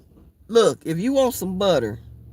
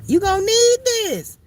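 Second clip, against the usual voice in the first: same line pitched way up; high squeaky take; 10 semitones higher than usual. Acted.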